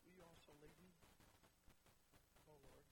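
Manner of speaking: low, soft, solemn voice; hushed question. Acted. Preacher returning to Jesus' question, hushed. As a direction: let it fall quietly after a long pause.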